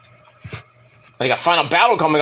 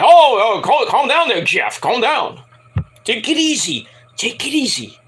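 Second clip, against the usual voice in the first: mock calming; 5 semitones higher than usual. Dutt calming down angry Jarrett, mock soothing. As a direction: talk a hothead down gently. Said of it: "Sanjay Dutt's like".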